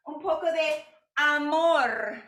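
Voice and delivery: high, tender Spanish phrase. Acted. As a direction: sweetly croon "Un poco de amor". Cooing over the tortillas.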